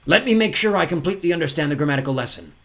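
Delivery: unruffled villain. A narrator's reading. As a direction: feign interest in the lesson. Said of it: "as if nothing had happened".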